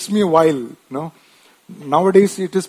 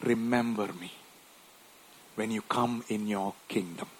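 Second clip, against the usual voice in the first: soft, low plea to the Lord; humble plea; 6 semitones lower, 7 dB quieter than usual. Retold or imitated. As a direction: beg quietly for mercy. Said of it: "he turns to Jesus and said".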